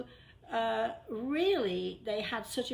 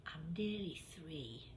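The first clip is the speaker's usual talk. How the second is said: whisper; 3 semitones lower, 9 dB quieter than usual. Retold or imitated. Storyteller repeats the shy child's words in a whisper. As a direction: breathe it like a shy toddler. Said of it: "she whispers to me".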